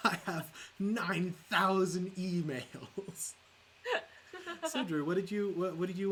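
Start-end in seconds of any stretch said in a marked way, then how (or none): 0.1-3.3 higher, louder, drawn-out mock complaint; mock exasperation. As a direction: stretch it out slowly as a put-on wail. Mock quote of overwhelmed man.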